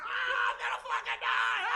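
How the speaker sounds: very high, hammed-up terrified scream; crying panic. Rider hit by branches in dark forest, high screaming panic. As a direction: wail in mock terror. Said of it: "I'm crying on a horse".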